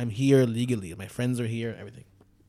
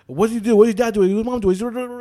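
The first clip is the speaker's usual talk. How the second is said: imitating a barrage of yelled questions; higher, louder, questions tumbling over each other; rapid-fire yelling; 9 semitones higher, 8 dB louder than usual. Imitating a cop yelling questions at him, rapid-fire. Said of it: "yelling at me questions, like, really fast".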